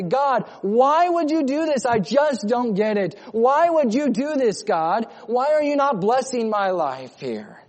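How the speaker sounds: high, loud imitated plea; acted anguished complaint, without real tears; crying out to God. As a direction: plead upward in mock bewildered protest. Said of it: "you're crying out to God and you're weeping and crying".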